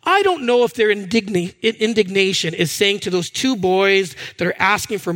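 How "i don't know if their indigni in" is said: usual speaking voice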